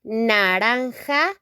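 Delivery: naranja slowly, with a rasping j; teacher modelling naranja; Spanish pronunciation. Shown as a demonstration.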